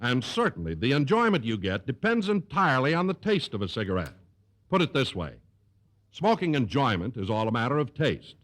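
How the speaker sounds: smooth, confident announcer pitch; commercial read. Acted. Announcer reading the Lucky Strike ad to listeners, polished sales voice. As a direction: sell the cigarette like a radio sponsor spot. Don reads the sponsor commercial.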